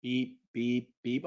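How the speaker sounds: soft imitated heart monitor beeps; quiet pretend beeping; mock monitor beeps. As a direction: beep like a calm hospital monitor. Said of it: "It's a heart rate monitor".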